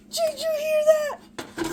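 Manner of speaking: drawn-out high squeal; excited. Real, not acted. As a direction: stretch it high with delight. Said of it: "I got excited".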